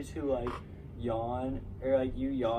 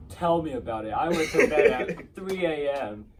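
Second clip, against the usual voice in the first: loud mocking one-upper; 4 semitones higher, 9 dB louder than usual. Mocking one-upping tired people.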